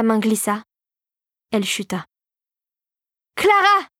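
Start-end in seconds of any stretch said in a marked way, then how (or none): 3.4-3.9 high, desperate cry; yelled name. A narrator's reading. The captain watches Clara disappear, desperate high yell. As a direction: cry out high after someone falling. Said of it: "hurla le capitaine".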